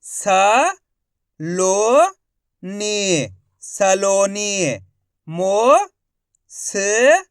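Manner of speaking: long drawn syllables with pauses between; stretched spelling drill; syllable-by-syllable sounding out. Shown as a demonstration. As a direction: sound out each syllable slowly, then blend the word. Teacher sounding out words by syllable.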